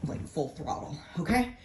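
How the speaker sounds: breathless, winded delivery; out of breath. Real, not acted. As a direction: talk while catching your breath. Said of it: "I'm out of breath".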